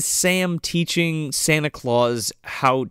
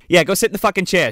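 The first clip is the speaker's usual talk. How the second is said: ordinary talk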